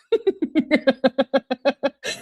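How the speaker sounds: high, sustained giggling; long helpless giggle; laughter. Real, not acted. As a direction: crack up laughing. Cracks up after reading message.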